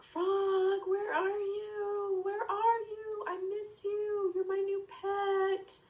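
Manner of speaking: high, slow, drawn-out call. Acted. Boy searching for his missing frog, long high calling. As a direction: plead loudly for a lost pet. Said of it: "They went on looking for the frog".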